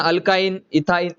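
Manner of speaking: normal talking voice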